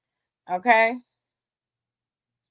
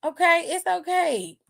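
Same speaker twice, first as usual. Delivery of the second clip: put-on higher, sing-song girl-just-talk-normal; sassy mock reassurance, higher; high sassy scolding; 6 semitones higher than usual. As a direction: talk down to her like a child. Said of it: "It's like".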